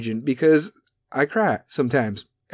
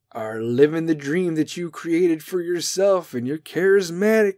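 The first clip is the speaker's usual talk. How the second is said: ordinary talk, nothing marked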